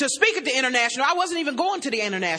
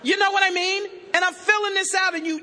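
ordinary delivery

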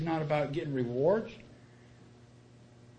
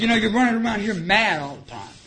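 blunt confrontation; 5 semitones higher, 6 dB louder than usual. Retold or imitated. Sponsor confronting him bluntly.

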